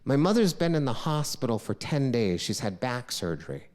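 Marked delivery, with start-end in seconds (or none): none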